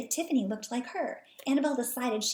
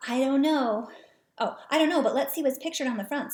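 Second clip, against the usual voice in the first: louder with more lift; louder, livelier reread; 4 semitones higher, 5 dB louder than usual. Shown as a demonstration. Reading Annabelle's line again, louder and livelier. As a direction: give it more life. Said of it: "reread more expressively".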